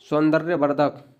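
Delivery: normal talking voice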